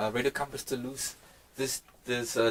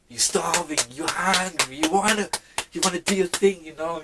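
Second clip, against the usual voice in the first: rising, louder and higher, driving hard; hyped about the hunger that pushes you; fired up; 6 semitones higher, 9 dB louder than usual. Real, not acted. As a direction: build it up like a rallying cry.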